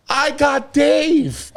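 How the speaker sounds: very slow, high put-on stranger voice; imitated stranger. Stranger telling him he found Dave, very slow and high. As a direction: stretch every word out as the stranger. Tall stranger at rave speaks.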